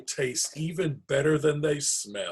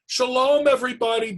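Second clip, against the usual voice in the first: cheerful greeting; 13 semitones higher, 8 dB louder, faster than usual. Acted. Bubba greets friends at door.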